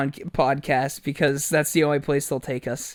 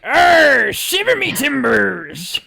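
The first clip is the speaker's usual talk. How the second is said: loud, high, slow hammed-up pirate growl; pirate attitude; 9 semitones higher, 7 dB louder, much slower than usual. Shown as a demonstration. Showing his cohost the pirate line with more attitude. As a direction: give it full swaggering pirate bravado. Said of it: "a little bit more attitude".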